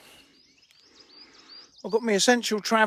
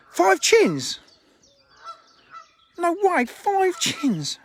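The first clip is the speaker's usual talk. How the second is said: mock disbelief; 10 semitones higher, much slower than usual. Real, not acted. Teasing mishearing of "five.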